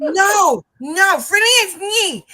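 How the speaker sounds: high, loud, drawn-out protest; frustrated yelling. Retold or imitated. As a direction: imitate an exasperated student's garbled yell. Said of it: "she's yelling at me".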